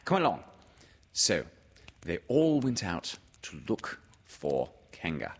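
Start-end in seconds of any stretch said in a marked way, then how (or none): 0.0-0.4 high, pleased character voice; bossy approval, pitched up; Rabbit. Acted. Rabbit hurrying Pooh along, in a high voice. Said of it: "said Rabbit".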